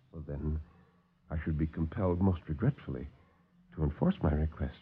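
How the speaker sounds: low, soft, smooth villain; calm the visitors like a silky host; suave doctor. Acted. Doctor soothes the alarmed officers, low and soft.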